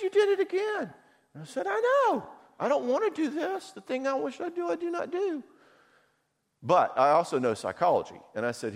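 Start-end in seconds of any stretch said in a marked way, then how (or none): 0.0-0.9 much higher, accusing; wife's voice. Retold or imitated. Wife telling husband he did it again, high accusing voice. 1.7-5.4 much higher, whining; whiny confession. Retold or imitated. Husband confessing the repeat to wife, high whiny voice.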